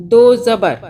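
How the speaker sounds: "दो ज़बर" said clearly, a loud teacher's drill; loud teaching drill. Shown as a demonstration. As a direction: call out "दो ज़बर" to the class. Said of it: "अब ये हमज़ा". (ज़ोर से, सिखाते हुए)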